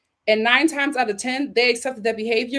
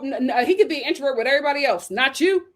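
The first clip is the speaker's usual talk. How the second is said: rapid rebuttal; 4 semitones higher, faster than usual. Real, not acted. Fast rebuttal.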